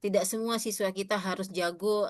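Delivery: ordinary delivery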